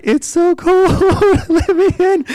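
high, laughing plea at a locked door; pleading to be let in. Retold or imitated. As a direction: beg them to open up, half laughing. Begging at the door in cold.

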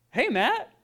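high, loud mock-startled hello; surprised greeting. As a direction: call out as the astonished neighbor. Neighbor's startled greeting, retold.

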